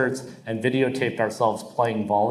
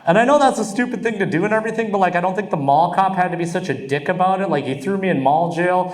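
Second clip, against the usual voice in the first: fast, higher indignant rant; excited; 6 semitones higher, faster than usual. Real, not acted. Comic complaining about the mall cop, fired up. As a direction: rush it out, worked up.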